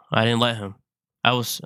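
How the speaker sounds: ordinary delivery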